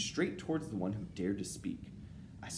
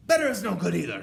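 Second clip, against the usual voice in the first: high, very loud witch retort; angry shriek; 11 semitones higher, 13 dB louder, faster than usual. A narrator's reading. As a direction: shriek back in contempt. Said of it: "shrieked the Grand High Witch".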